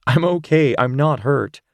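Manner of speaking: calm him down, she's unhurt; Rachel reassuring. Acted. Rachel comforts Zane.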